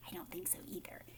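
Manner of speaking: a hushed, conspiratorial whisper; confiding whisper. Real, not acted. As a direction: whisper it like a shared secret.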